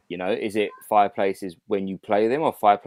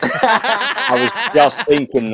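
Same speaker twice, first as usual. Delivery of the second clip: laughing; 7 semitones higher, 8 dB louder, much slower than usual. Real, not acted. Joking about tumbleweed sound.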